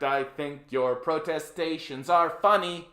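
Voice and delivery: normal talking voice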